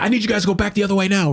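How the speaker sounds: fast, high-pitched mock command; imitated officer's order. Riot officer sending the crowd back, imitated order.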